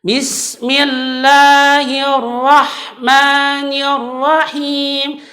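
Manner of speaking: slow recitation, unclear mim; wrong ending. Shown as a demonstration. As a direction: bismillahirrahmanirrahim with faint m. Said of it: "kesalahannya lagi biasanya". (bismillahirrahmanirrahim salah)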